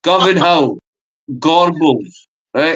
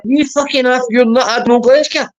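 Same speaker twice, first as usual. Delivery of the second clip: heated; 7 semitones higher, faster than usual. Real, not acted. Heated argument with friend.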